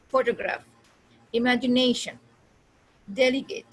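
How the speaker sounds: Photograph, imagination, delegate said apart with punched stresses; careful model stress on photograph, imagination, delegate; demonstrating word stress. Shown as a demonstration. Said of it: "उसको बोलना भी है".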